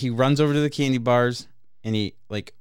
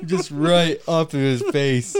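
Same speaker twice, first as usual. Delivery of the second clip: laughing; 2 semitones higher, 4 dB louder, slower than usual. Real, not acted. Laughing while telling gross story.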